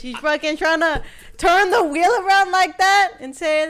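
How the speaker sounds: loud accusation. Real, not acted.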